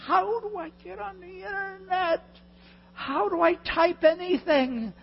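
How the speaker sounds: high, drawn-out whining wail; exaggerated frustration. Preacher reliving a fight with the computer, frustrated wail. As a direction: wail it helplessly, stretching the words. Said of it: "I'm so frustrated. I'm saying".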